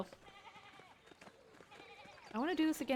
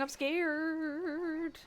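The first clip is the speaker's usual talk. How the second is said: mock scared; 6 semitones higher than usual.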